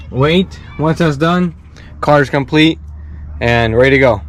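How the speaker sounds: ordinary talk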